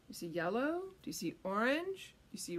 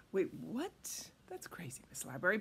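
whisper; 8 semitones lower, 7 dB quieter than usual. Acted. Mock surprise at silly instruction.

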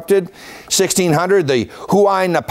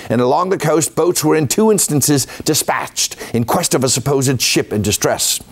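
poor mock-Cockney accent, pitched up at first; Sir Thomas Raffles; 2 semitones higher than usual. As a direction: read the old quote in an amateur Cockney accent. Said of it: "I apologize for the Cockney accent, that's poor".

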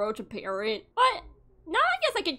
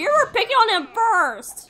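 higher, louder scolding; indignant scold; 9 semitones higher, 7 dB louder than usual. Real, not acted. Scolding the girl.

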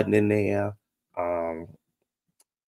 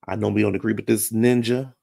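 ordinary talk, nothing marked

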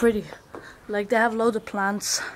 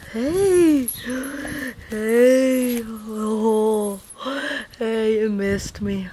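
pitched-up pet talk; doggy greeting; much slower than usual. Real, not acted. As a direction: coo at the dog as it wakes. Greeting a cute sleeping dog.

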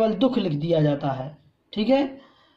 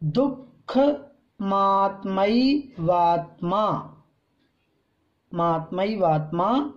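मात्मैवात्मा slowly again, falling at the end; slow teacher repetition; slow recitation; slower than usual. Shown as a demonstration. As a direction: repeat मात्मैवात्मा slowly for students. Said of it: "इसको ठीक से पढ़ लें।".

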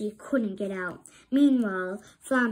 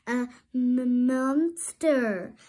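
stammering fear; 4 dB louder, slower than usual. A narrator's reading. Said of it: "Flounder blurted out".

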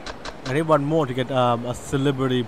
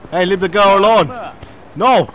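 shouting; 6 semitones higher, 8 dB louder than usual. Acted. Defending a girl in game.

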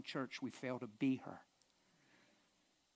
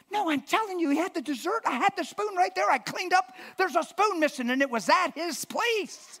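much higher, louder, indignant wife voice; shrill insistence, she won't be doubted; wife protesting to her husband about the missing spoon; 12 dB louder than usual. Retold or imitated.

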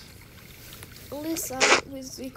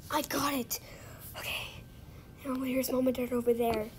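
ordinary talk, nothing marked

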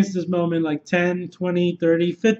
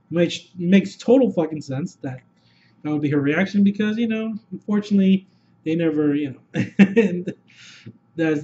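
ordinary talk

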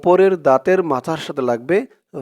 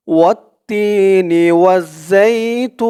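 Quran recitation; 3 semitones higher, much slower than usual. Shown as a demonstration. Teacher reciting for viewers to repeat, slow tajweed chant. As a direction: recite it slowly so students can follow. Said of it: "আমার সাথে বলুন".

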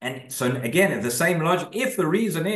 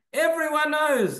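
drawn-out, high-pitched storybook exclamation; high, stretched-out quoted exclamation; exclaiming; 13 semitones higher, slower than usual. A narrator's reading. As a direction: read it as a slow exclamation, pitched way up. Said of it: "he exclaimed".

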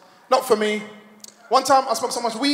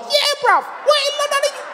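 put-on very high, squeaky little-kid challenge; mock small child joining the gang; five-year-old kid; 4 dB louder than usual. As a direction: squeak it like a tiny tough kid. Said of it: "They had a little kid about five years old".

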